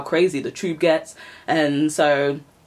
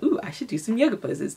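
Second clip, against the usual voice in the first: retold eager impulse; 7 semitones higher than usual. Acts out her eager impulse.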